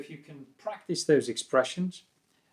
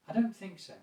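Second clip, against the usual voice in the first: mild, non-aggressive disagreement, high then falling; politely doubt it; slightly negative; 7 semitones higher, 4 dB quieter than usual. Shown as a demonstration. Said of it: "slightly more negative there, but not aggressive".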